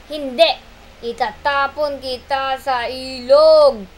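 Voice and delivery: monkey vows to throw turtle; loud refusal. Acted. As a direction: declare it slowly, threatening. Matsing refuses angrily.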